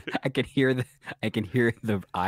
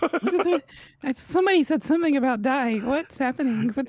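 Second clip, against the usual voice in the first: much higher, startled-guest voice; mock alarm. Co-host plays a guest overhearing talk of dying, high alarm. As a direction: ask like a flustered partygoer.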